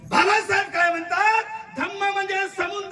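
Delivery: booming high preacher's cry to the crowd; fiery rally orator; preaching at full force. Acted. Preaching voice returns.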